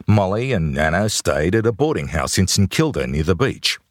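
plain reading voice

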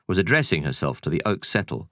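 plain reading voice

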